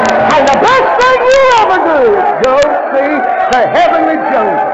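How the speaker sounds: loud, high, ringing shout of praise; preaching at full force. Acted. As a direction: lift the whole church with it. Preacher climax, hallelujah shout.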